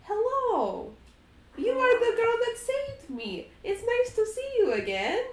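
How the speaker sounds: put-on high, small child voice; child Strahd, high and sweet; little Strahd. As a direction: play a young boy greeting a friend. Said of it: "And you see little Strahd".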